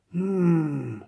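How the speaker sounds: drawn-out groan of relief. A narrator's reading. Gorilla getting his back scratched, long relieved groan. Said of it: "he groaned".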